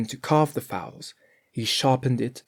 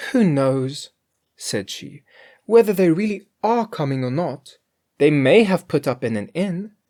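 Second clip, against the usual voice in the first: Grethel's voice; 5 semitones higher, 5 dB louder than usual. A narrator's reading.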